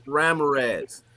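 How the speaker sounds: Ramirez said as RAM-uh-rez; changed anglicized pronunciation. Shown as a demonstration. Teacher introducing himself to students, Ramirez said as RAM-uh-rez. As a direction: hit the first syllable of Ramirez, English vowels. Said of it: "I changed the pronunciation".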